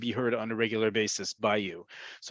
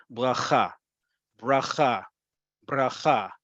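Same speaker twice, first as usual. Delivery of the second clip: "bracha" in separate takes, each weighted on "cha"; stress on last syllable; slower than usual. Shown as a demonstration. Teacher drilling the emphasis for students, "bracha" stressed on its final syllable. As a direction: repeat "bracha", varying the r, always stressing the end. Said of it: "the last syllable gets the emphasis".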